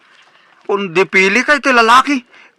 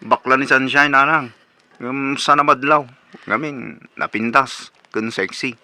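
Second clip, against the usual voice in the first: shy murmur; 5 semitones lower, 6 dB quieter than usual. Acted. Son confides shyly about love.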